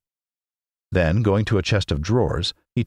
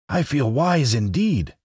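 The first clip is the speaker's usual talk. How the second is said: slow, higher Scarecrow, earnest; 8 semitones higher, slower than usual. A narrator's reading. To Dorothy, the Scarecrow earnestly claims wisdom. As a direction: says it slowly, meaning every word. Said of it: "he answered earnestly".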